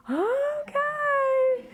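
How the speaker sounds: high, long drawn-out "okay"; bracing reluctance. Acted. Staffer sees difficult repeat customer walk in, bracing. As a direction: stretch it out high, bracing yourself.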